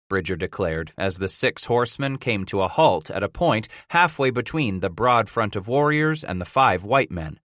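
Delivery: ordinary delivery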